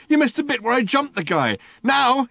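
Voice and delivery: high, loud outrage; raging. Acted. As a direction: rage at him for missing it. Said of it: "raged Ford".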